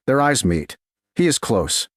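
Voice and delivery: plain reading voice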